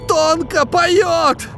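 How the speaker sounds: slow, high strained rasp; hoarse wheeze. A narrator's reading. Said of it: "прохрипел он в камеру".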